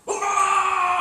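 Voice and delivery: very high, loud excited yell; yelling for mom. Retold or imitated. Kid kicking the door open, very high loud yell. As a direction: burst through the door yelling. Kid bursts in with report card.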